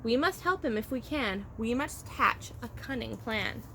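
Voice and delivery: ordinary delivery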